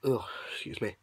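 usual speaking voice

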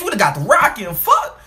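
normal talking voice

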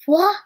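"w" as a speech sound, not the letter name; isolated phonics sound. Shown as a demonstration. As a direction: sound out "w" by itself, as in weed. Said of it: "sound can be heard at the beginning".

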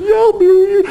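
mock teary high-pitched yes; teary-eyed. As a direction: imitate a woman choked up with happy tears. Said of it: "she's all teary-eyed".

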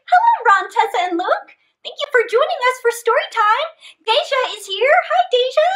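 high, loud bright hellos; cheerful livestream welcome; excited greetings. Real, not acted. Greeting viewers by name.

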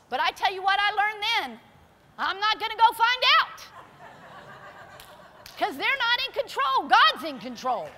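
loud, high preaching. Acted. Preacher telling the church God's in control, loud and high. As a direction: drive the point home to the church.

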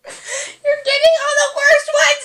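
high, slow, drawn-out cry; loud wail. Real, not acted. Friend watching the wasabi reaction, loud wail.

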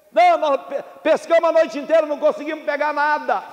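high, projected reply; calling back. Acted. Fishermen answer the shore with no catch, calling back. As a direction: call back from the boat.

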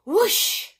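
high, loud whooshing exclamation; whoosh effect. A narrator's reading. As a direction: sweep the word like a rush of air. Sound effect in story.

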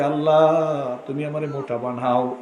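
ordinary talk

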